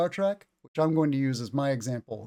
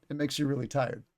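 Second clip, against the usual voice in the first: ordinary delivery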